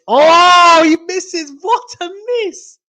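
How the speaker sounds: very high, loud cry of dismay, fading after the first word; excited shout. Real, not acted. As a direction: shriek at the big miss. Commentator reacts to missed volley.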